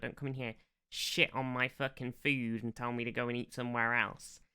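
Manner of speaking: normal talking voice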